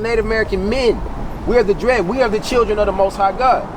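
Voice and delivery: ordinary talk, nothing marked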